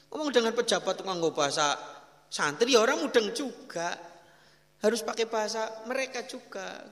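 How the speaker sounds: forceful preaching. Acted. Preacher urging congregation to speak their language, forcefully. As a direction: press the lesson home.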